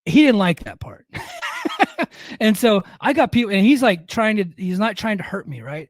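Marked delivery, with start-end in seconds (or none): 1.1-2.4 high unvoiced wheeze of a laugh; breathy silent laugh. Real, not acted. Laughs at his own story.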